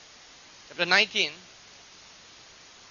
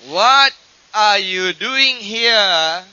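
sudden booming voice; 9 dB louder, much slower than usual. Shown as a demonstration. Preacher reading the voice that came to Elijah, loud and drawn out. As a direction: stretch it out slowly and ring it out. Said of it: "difference between the still small voice and suddenly a voice".

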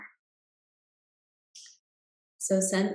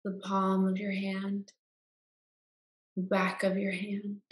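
guided meditation. Acted. Guide leading listeners into Yoga Nidra rest, calm meditation voice.

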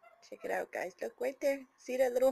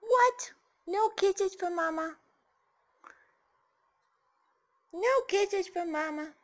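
high, louder mock disappointment; 8 semitones higher, 5 dB louder than usual. Real, not acted. Mother to parrot refusing kisses, high mock hurt.

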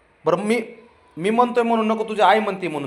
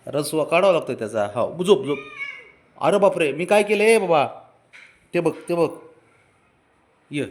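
ordinary delivery

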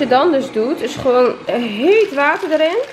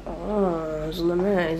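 softer and slow, let down; disappointed; 4 semitones lower, 8 dB quieter, slower than usual. Real, not acted. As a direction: sigh it out, let down. Partner ate without her.

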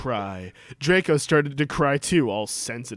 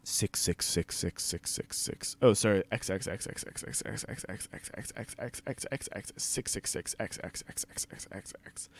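soft, lower, fading to a whisper; mock eerie whisper; 6 semitones lower, 8 dB quieter than usual. Reading the author's note, a fading whispered chant. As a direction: murmur it like a creepy incantation.

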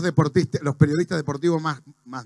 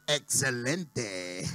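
slow, low, soft sinister boss; Señor Burns; 8 semitones lower, 10 dB quieter, much slower than usual. Acted. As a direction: draw it out very softly and low. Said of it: "Señor Burns". (jefe siniestro, grave, suave y muy lento)

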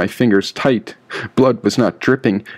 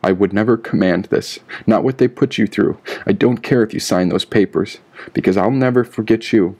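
plain reading voice

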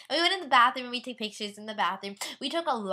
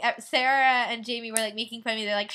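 normal talking voice

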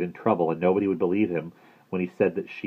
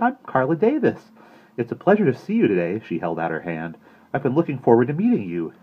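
lightly raised, friendly woman's voice; a kind counselor welcoming a boy; cheerful counselor; 5 semitones higher, 4 dB louder than usual. Acted. Carla greets Bradley brightly.

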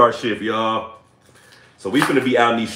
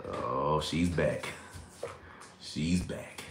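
quiet; 9 semitones lower, 14 dB quieter, much slower than usual. Real, not acted. Quiet remark.

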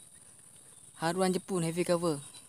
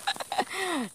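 high delighted laugh; happy laugh; 12 semitones higher than usual. Real, not acted. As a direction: laugh with joy at the catch. Laughing after the catch. (ketawa gembira)

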